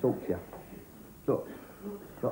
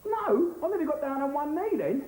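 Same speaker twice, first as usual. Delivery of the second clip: stunned disbelief; 12 semitones higher than usual. Acted. Proposal refused, stunned disbelief.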